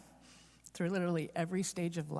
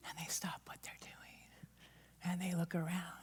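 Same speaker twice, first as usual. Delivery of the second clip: whispered, much softer narration; hushed whisper; 9 dB quieter than usual. Real, not acted. Preacher telling the congregation how sheep stop, in a whisper.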